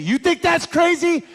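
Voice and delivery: very high, loud, drawn-out retort; acted escalation in a lovers' fight; indignant girlfriend.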